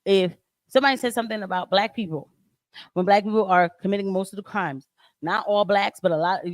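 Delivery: usual speaking voice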